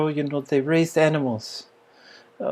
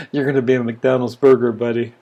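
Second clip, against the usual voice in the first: mock taunt; 5 semitones lower, 5 dB louder, faster than usual.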